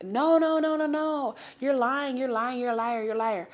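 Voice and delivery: loud, high frantic denial of his lies; screaming accusation. Retold or imitated. Girlfriend yelling at her lying boyfriend, loud and frantic. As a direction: yell it at him like you've caught him.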